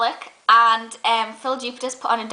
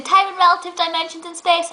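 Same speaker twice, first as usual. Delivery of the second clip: higher-pitched mimicry of a know-it-all; know-it-all butting in; 6 semitones higher than usual. Panellist interrupting another's answer, know-it-all mimicry. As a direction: cut in with the answer, mockingly smug. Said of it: "being the know-it-all that she thinks she is, butted in".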